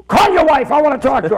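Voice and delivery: mock-bossy shout; barked demand. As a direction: demand it at full voice, easing slightly after. Ranting old man demands wife.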